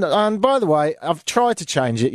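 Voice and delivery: ordinary talk, nothing marked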